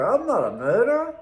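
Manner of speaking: put-on teen snapping back at the coppers; indignant denial. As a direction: insist on it, stung and fed up. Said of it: "I go".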